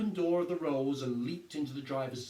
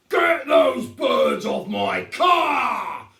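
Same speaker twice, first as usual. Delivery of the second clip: furious shout, much higher and louder, very slow; bellowing; 6 semitones higher, 16 dB louder, much slower than usual. A narrator's reading. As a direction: roar at the fool near his car. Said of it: "Mister Hazel bellowed".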